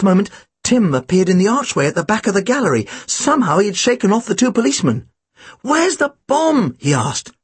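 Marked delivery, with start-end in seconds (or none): 5.6-6.8 high, slow drawn-out question; high-voiced, slow alarm; Tim's urgent question. A narrator's reading. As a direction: ask it pitched way up, stretched. Tim bursts in asking about bomb.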